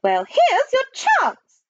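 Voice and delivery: high, slow snarl. A narrator's reading. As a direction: snarl it slowly as a severe nanny. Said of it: "snarled Miss Tibbs".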